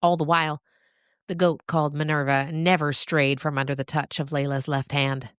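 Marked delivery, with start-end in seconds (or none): none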